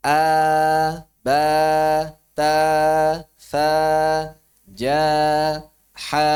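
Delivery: thin letters, sound kept forward. Shown as a demonstration. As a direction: say A, ba, ta flat and light, no echo. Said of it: "Huruf tipis enggak?". (huruf tipis, tanpa gema)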